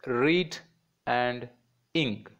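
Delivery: "read", pause, "ing"; syllable by syllable. Shown as a demonstration. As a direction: break "reading" into two separate beats, "read" and "ing". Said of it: "the word reading has two syllables".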